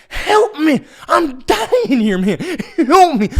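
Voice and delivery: high, loud, drawn-out mock plea; desperate cry for help. Driver begs farmer to save him from turkeys, desperate. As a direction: wail for rescue at full voice.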